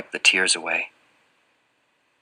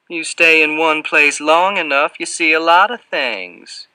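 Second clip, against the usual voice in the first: higher-pitched Southern character taunt; 8 semitones higher, 4 dB louder than usual. A narrator's reading. Fat man playing games with someone who asks about a bike, taunting. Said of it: "his tone taunting now".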